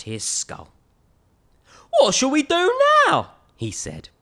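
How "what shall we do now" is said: much higher, louder storybook voice for the big skeleton. Acted. Big skeleton asks his friend what next, higher voice. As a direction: play the big skeleton, bright and puzzled.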